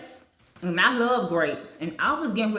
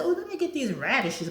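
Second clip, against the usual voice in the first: excited; 7 semitones higher, faster than usual. Retold or imitated. Quoting herself at the store.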